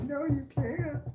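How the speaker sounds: wife shutting it down firmly; insistent wife. Retold or imitated. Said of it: "She goes".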